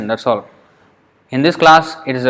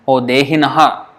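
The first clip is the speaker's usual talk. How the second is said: ordinary talk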